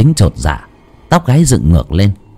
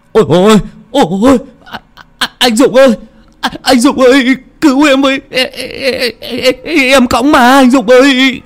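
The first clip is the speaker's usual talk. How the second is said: terrified cry for help. Acted. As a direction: scream for your brother, frozen with terror. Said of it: "chú Tính dùng sức kêu lên".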